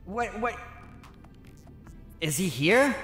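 ordinary talk, nothing marked

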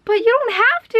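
high, loud mock protest. At the register, she protests he shouldn't pay, high and loud. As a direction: squeak out a polite protest. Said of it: "And I'm just like".

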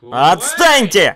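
drawn-out loud cry; slow angry shout. Acted. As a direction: bellow it, hurt. Sulking loser. (медленный крик)

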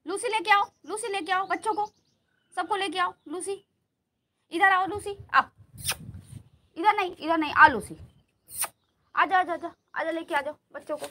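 high, loud calls to the dogs; calling out. Real, not acted. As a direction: summon pets from far away, pitched high. (ज़ोर से ऊँची आवाज़ में पुकारते हुए)

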